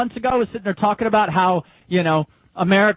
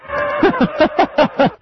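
eerie high laugh; 4 dB louder than usual. Real, not acted. Laugh from the stray audio.

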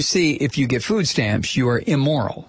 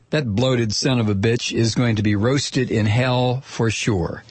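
ordinary delivery

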